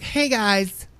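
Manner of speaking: high, loud mock vlogger hello; YouTuber greeting. Host mocking YouTubers' openings, hammed-up perky greeting. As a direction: mimic a gushing YouTuber intro. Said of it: "most YouTubers that you listen to, they're like".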